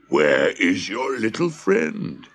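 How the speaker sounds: slow giant voice with a touch of worry; anxious giant. A narrator's reading. Giant asking children about the missing boy, mildly anxious. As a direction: draw out the worried question. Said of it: "he asked anxiously".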